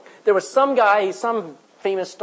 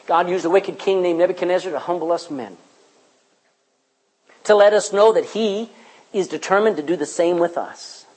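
ordinary talk, nothing marked

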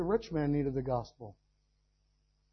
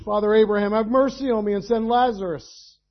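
loud, raised begging cry from torment; pleading rich man; 4 semitones higher, 9 dB louder than usual. Acted. Preacher voicing the rich man begging Abraham, loud plea. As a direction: beg Father Abraham for mercy, loud.

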